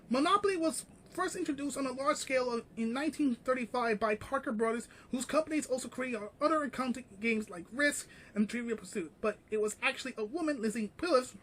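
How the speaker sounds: quiet, quick reading of a news article. Acted. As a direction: read the article out quickly and softly. Reads article quietly and fast.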